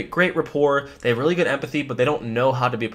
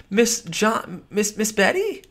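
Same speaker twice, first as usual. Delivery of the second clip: curious tone; 7 semitones higher, slower than usual. Shown as a demonstration. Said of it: "You see my eyes when I was doing that?".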